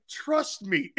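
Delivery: high-pitched teasing imitation; mock assurance. Co-host mimicking Dan's promise to Frank, mock assurance. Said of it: "Dan's like".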